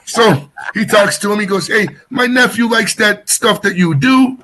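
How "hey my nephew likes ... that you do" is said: lightly voiced old-school Italian New Yorker, higher; a hint of a Jersey Italian uncle on the phone; Tony Danza-style uncle. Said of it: "he talks like Tony Danza".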